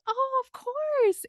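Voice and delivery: very high, drawn-out, hammed-up agreement; gushing agreement. Charmed staff answering the British boss, mock gushing. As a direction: gush it loud and high, stretching the words.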